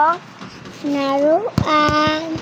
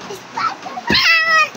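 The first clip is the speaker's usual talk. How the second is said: drawn-out, much higher Spider-Man call; playful hero cry; 13 semitones higher, much slower than usual. Pretend. Child jumping for the vlog, playful high hero cry. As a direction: call it out like a kid playing hero.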